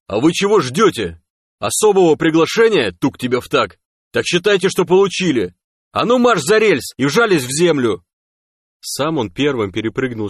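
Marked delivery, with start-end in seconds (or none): 0.1-8.0 high, loud impatient bawling; angry commanding shout. Acted. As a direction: shout at dawdlers to get down now. Stalker orders the others to cover. (громкий злой командный окрик)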